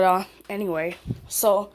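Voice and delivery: usual speaking voice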